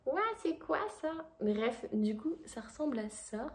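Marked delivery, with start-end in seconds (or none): 0.1-1.1 high, wide-eyed mock wonder; amazed. Maïka stares at the new camera, mock amazed. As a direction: voice Maïka gawking at the gadget. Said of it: "elle me regarde genre en mode".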